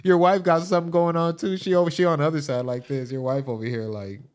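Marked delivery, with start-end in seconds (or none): none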